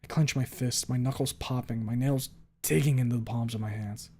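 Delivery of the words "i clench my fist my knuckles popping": ordinary delivery, nothing marked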